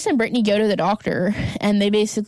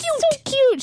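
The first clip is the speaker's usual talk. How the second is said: echoing coo. Real, not acted. Echoing the cooing.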